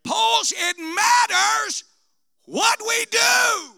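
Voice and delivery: loud, high, shouted sermon climax; full-force preaching. Acted. Preacher crying out to his church, shouting. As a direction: preach it out with fire.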